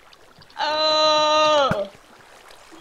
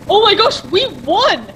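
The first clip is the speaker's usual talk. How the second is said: louder, higher cheer of winning; excited; 5 semitones higher, 5 dB louder than usual. Real, not acted. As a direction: cheer the win. Team wins the game.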